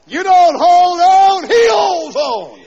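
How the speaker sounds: slow, high shouted correction; preaching shout. Real, not acted. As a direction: thunder it at the congregation.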